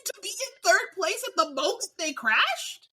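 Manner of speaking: high squealing disbelief, stretched slowly; incredulous outrage. Acted. Fan ranting at the race rating, squealed mock outrage. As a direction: howl the question in mock despair.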